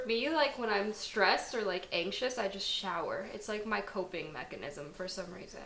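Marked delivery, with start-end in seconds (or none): none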